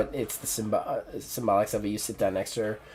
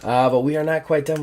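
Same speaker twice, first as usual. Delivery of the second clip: ordinary talk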